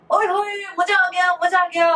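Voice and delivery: high, excited mock cheer; excited yell. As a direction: cheer it out like a thrilled fan. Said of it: "संता जोर जोर से चिल्लाया". (ऊँची आवाज़ में जोश से चिल्लाना)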